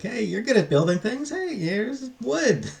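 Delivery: a hammed-up cheerful dad; imitating dad. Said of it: "So he's like".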